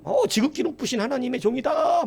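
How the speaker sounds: high-pitched, taunting proclamation; mocking jeer. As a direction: jeer it out in a high, sustained call. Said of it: "막 조롱하는 거예요".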